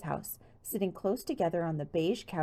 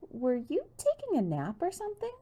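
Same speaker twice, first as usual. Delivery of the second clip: lightly voiced bubbly girl; 12 semitones higher than usual. Bess's high voice.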